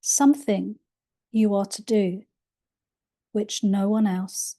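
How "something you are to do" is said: ordinary delivery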